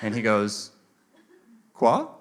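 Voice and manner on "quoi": lightly voiced baffled French "quoi"; puzzled Frenchman. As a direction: question it like a confused Frenchman. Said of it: "I heard a guy speaking French".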